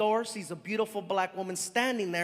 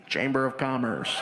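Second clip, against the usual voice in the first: very low monotone answer; deep put-on disguise voice; fake official at the door; 11 semitones lower than usual. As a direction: drop the pitch right down, deadpan.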